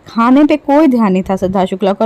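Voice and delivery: ordinary talk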